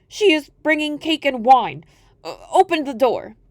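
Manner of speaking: high, loud put-on girl voice; the wolf pretending at the door; wolf faking a girl.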